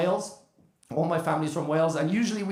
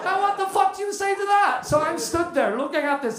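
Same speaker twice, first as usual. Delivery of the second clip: excited retelling; 10 semitones higher than usual. Retold or imitated. Comic to the crowd about the woman's question, laughing disbelief. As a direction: tell it rising with disbelief.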